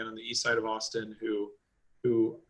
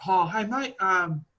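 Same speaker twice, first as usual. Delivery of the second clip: Kiwi friend voice; 8 semitones higher than usual. Retold or imitated. Kiwi friend quoted asking for helmet.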